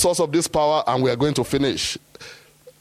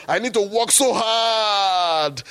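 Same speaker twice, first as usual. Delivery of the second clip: slow, raised mock whine with "so hard" drawn out; mock protest, first take; 8 semitones higher, slower than usual. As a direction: ham up the plea. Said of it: "I said".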